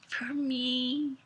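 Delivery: soft fearful whisper. Acted. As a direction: hush it, scared. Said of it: "whispered Mariana".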